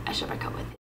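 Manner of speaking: hushed, breathy whisper of the answer; whispered realization. Retold or imitated. Telling viewers her answer to God, whispered. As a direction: breathe the decision out like a secret.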